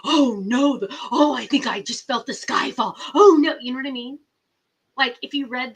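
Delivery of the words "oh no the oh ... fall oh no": loud hammed-up alarm; mocking panicked television news. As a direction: act like a scared newscaster. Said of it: "On camera".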